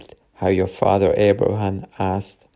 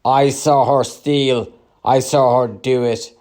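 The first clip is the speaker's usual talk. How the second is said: shouting; 4 semitones higher, 5 dB louder than usual. A narrator's reading. Second man accusing a woman in the market, shouting. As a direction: call out as an insistent witness. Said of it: "The other was shouting".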